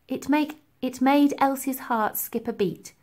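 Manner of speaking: ordinary delivery, nothing marked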